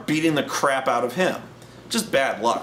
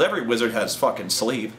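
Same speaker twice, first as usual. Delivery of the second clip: ordinary talk, nothing marked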